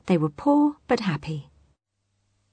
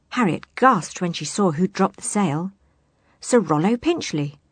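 ordinary delivery

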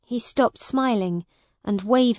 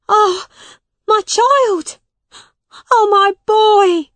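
high, loud anguished mother's cry; wailing shout, pitched way up; 12 semitones higher, 11 dB louder than usual. A narrator's reading. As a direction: cry out to your lost child. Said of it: "she cried".

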